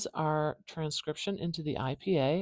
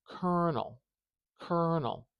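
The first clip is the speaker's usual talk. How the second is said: "colonel" as KER-nel, with a long "er"; long stressed first syllable; 3 semitones higher than usual. Shown as a demonstration. Teacher showing viewers syllable stress, long first vowel. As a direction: stretch and stress the "er" of "colonel", then fall. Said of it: "do you hear how long that er is?".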